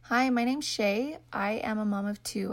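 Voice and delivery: normal talking voice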